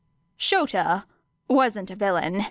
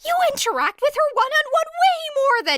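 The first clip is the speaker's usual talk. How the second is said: much higher and louder, cut-off protest; Hizashi voice; 5 dB louder, faster than usual. Acted. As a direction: Hizashi yelping back, cut off.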